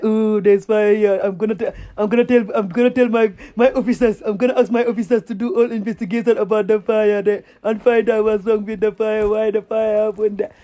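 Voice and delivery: sing-song mocking quote on a level pitch; mock president. Mimicking the president at the fire, mocking. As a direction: parody an official droning out empty promises. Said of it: "He was like".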